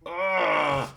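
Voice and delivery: sharp cry of pain. Acted. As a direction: cry out as if scalded. Mark burning pain.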